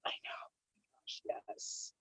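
hushed agreement; whisper. Real, not acted. Host agreeing softly with her cohost, whispered. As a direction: breathe it out as an aside.

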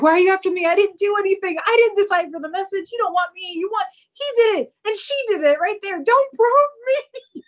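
high, frantic, pleading rant; freaking out. Retold or imitated. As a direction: beg the aliens in a hammed-up panic. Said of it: "he's freaking out".

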